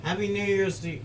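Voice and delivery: excited. Retold or imitated. Echoing the video's sign-off loudly.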